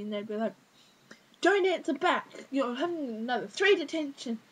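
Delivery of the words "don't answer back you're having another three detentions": louder, higher imitation of a cross teacher; mock teacher scolding. Teacher handing detentions to a student who answers back, mock scolding. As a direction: tell off a pupil, hammed up.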